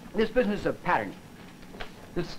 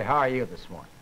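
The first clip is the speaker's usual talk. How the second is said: lower-pitched greeting that clashes with the friendly words; incongruent greeting; 6 semitones lower than usual. Shown as a demonstration. Lecturer showing a morning greeting that doesn't match, pitched low and incongruent. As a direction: say the greeting low, at odds with its meaning. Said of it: "that's not congruent".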